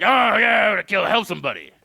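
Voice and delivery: mock eager volunteer; cheery helper voice. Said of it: "but he's not like".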